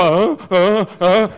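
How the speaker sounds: mock dazed "ah ah ah"; entranced moan. Youths heading off to the world, mock entranced moan. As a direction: drift off moaning like the bug.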